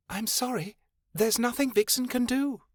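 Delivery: high, small fairy voice speaking of herself; a tiny high fairy, hissing; fairy Vixen voice. Acted.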